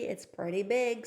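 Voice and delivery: ordinary delivery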